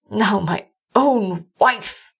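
very slow, halting grief; broken. A narrator's reading. Doctor grieving for his own wife, broken. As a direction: drag the words out, lightly broken. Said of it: "he said brokenly".

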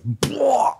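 high retching blah; mock barf. Walking into the hospital and throwing up, mock barf noise. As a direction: spit out a vomit noise. Imitated vomiting sound.

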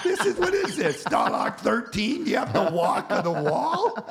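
loud, high-pitched mock tirade; losing it. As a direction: re-enact an exasperated rant at the boss. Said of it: "I just lost it".

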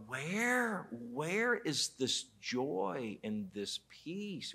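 soft, slow, hushed question; slow quiet wondering; hushed lament. Acted. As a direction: let it fall to a near hush. Pastor laments missing joy.

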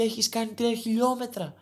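normal talking voice

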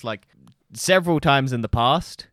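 normal talking voice

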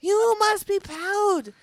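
put-on slow, high, loud French-accented cheer; gushing French coach praising her students; French teacher's accent. Teacher cheering her swim students in a French accent. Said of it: "She'll be like".

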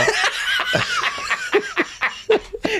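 squealing high-pitched laugh; real high cackle. Real, not acted. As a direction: burst into a high wheezing laugh. Laughing at own story.

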